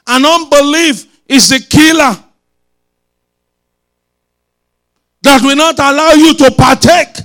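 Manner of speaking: loud, high, hammering declaration; forceful preaching. Real, not acted. Preacher warning the congregation about unbelief, forceful preaching.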